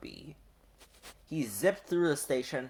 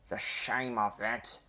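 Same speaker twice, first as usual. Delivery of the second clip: grumbling; 3 semitones lower, 5 dB quieter than usual. A narrator's reading. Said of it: "he grumbled".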